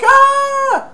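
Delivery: a high, loud burst of "Ah!" as if burned; mock scream. Burglar whose head catches fire, screaming high and loud. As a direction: shriek out at the top of the voice. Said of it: "'Cause he's like".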